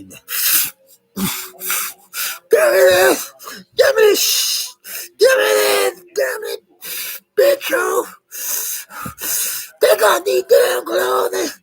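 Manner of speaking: very high, loud, strained craving cry; high wailing mock predator demands; frantic predator voice. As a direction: shriek like a desperate addict grabbing. Acting out predator grabbing victim.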